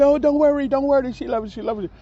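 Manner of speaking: put-on high, light voice of an excited older lady; reassuring friend. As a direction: soothe him, a bit high.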